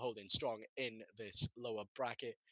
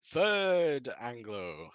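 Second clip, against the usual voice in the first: "Third Anglo" stretched very slowly and a bit louder; drawn out while typing; 2 semitones higher, 6 dB louder, much slower than usual. Real, not acted. Caster typing the team name, saying it slowly. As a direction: drag out "Third Anglo" word by word as you type it. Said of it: "I'm not writing the entire thing out".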